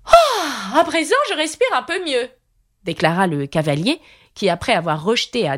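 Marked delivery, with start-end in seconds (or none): 0.0-2.3 high knight voice, a relieved ah; high knight relieved to breathe. A narrator's reading.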